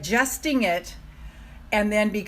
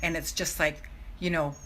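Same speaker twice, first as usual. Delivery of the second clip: ordinary delivery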